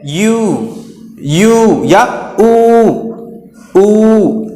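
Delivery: यू and ऊ held as long oo; long vowel sound. Shown as a demonstration. Teacher demonstrates the long sound of U, drawn-out यू, ऊ. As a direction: stretch यू and ऊ slowly. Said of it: "long sound है".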